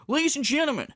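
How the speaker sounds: hammed-up show opener; Phil impression. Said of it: "And then he'll go".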